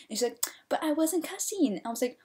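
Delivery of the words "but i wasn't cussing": high-pitched mock protest; put-on whine, falling at the end; whiny denial. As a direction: imitate a girl pleading innocence. Imitating girl denying cussing.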